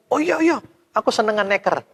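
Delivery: high, happy voice gladly accepting; a silly yes to a bad trade; naive eager agreement. Mother gladly accepting the marbles, high and eager.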